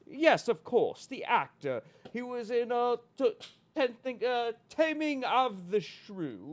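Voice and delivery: high-pitched refined British actor voice; lofty British thespian, recalling roles; Michael York. Acted. Recalling an actor's films for the host, high classy British. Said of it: "You sound like Michael York".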